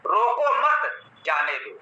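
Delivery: comma after मत, then drop on जाने दो; pause after मत. Shown as a demonstration. Teacher showing "let him go" reading, pause after मत. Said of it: "मत के पश्चात लगा दिया".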